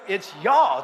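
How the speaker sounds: "y'all" run together as one drawled word. Shown as a demonstration. Pastor teaches the word, "y'all" as one syllable. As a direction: draw out "y'all" as a single syllable. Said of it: "It's one word".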